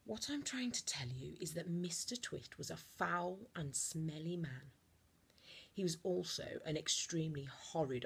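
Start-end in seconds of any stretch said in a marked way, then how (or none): none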